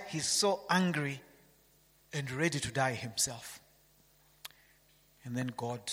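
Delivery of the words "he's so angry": ordinary delivery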